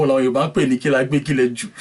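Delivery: fast, laughing through the proverb. Real, not acted. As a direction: rush the saying out while laughing. Host laughs at Yoruba saying.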